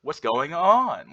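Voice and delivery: "going on" drawn out and swooping up; long rising lilt on "going on"; upward inflection. Shown as a demonstration. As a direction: stretch ON slowly upward, Valley Girl style. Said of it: "Like the, the upward inflection".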